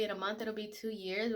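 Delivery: ordinary talk, nothing marked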